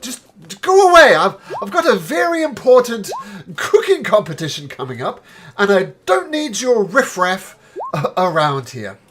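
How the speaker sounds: angry shout. Acted. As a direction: shoo them out furiously. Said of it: "he says".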